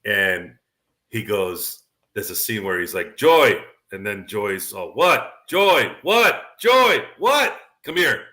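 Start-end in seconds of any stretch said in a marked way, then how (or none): 3.2-3.6 loud call of Joy pitched higher; Spike Lee impression. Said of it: "Tommy Davidson plays Spike Lee". 4.9-8.2 louder and climbing higher with each call; Spike Lee impression. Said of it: "Tommy Davidson plays Spike Lee".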